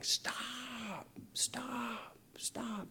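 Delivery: high, hushed mock scream. Two ladies running at the kids in church, mock screaming.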